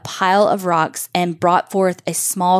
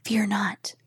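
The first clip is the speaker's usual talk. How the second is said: soft unvoiced whisper, very gentle; a tender, soothing whisper; 3 semitones higher, 8 dB quieter than usual. A narrator's reading. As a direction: hush it, calming a frightened man. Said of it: "even more gently than before".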